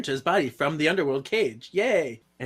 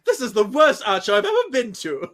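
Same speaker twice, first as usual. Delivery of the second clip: mock partygoer laughing; 9 semitones higher, 6 dB louder than usual.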